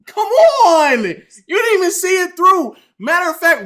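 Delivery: high, loud exasperation; real exasperated yell; exasperated shout. Real, not acted. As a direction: shout in disbelief at him. Exasperated at the texter.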